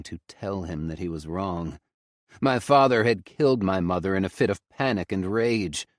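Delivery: usual speaking voice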